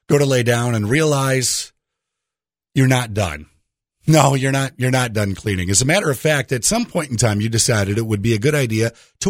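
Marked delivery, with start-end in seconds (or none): none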